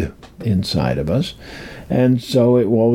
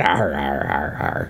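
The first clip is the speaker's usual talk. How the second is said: low, jawless arr growl; a hammed-up pirate arr; 10 semitones lower, 5 dB quieter than usual. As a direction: growl it like a ghost missing his jaw. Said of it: "talks like this".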